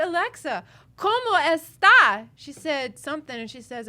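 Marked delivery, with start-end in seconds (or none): 0.0-2.3 high, loud, slow cómo está; calling to Alexa. Retold or imitated. Asking the home assistant a question, calling out loud. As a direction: call it out to the device. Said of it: "I said".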